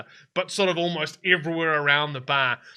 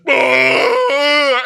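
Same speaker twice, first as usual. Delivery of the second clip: loud, high-pitched bear roar; 9 semitones higher, 9 dB louder than usual. Acted. Bear head pops up from the gore, loud roar. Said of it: "head of a bear then kind of pops up and goes".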